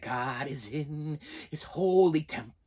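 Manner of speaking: put-on slow, hushed, eerie old preacher voice; creepy preacher impression. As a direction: intone it low and slow like a sinister pastor. Quoting the creepy old preacher Kane.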